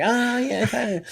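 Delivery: high hushed Leno voice; mock Jay, letting it sink in; imitating Leno, softening; Jay Leno impression. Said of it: "He's like".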